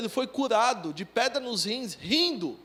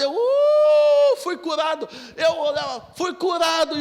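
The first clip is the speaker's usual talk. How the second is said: high, loud joyful cry; ecstatic shout; 5 semitones higher, 8 dB louder, slower than usual. Retold or imitated. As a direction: shout the healing out in triumph. Healed, shouting while laughing. (grito eufórico de cura)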